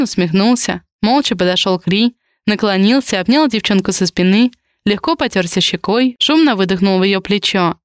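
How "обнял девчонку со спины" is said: plain reading voice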